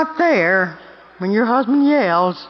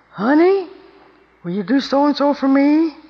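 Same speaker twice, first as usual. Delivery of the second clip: sweet husband asking; 6 semitones higher than usual. Retold or imitated. Husband's request voiced in story.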